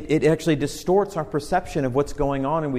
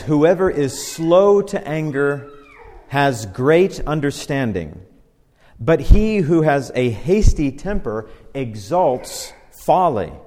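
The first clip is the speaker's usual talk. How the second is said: slow, weighty reading of a proverb; slow, solemn Bible reading; scripture reading; 5 dB louder, slower than usual. Acted. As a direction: read it out like holy writ, slowly. Reads scripture aloud.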